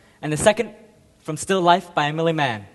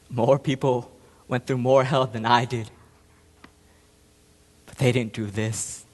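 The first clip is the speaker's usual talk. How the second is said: subdued self-blame; 6 semitones lower than usual. Acted. Self-blame after the outburst.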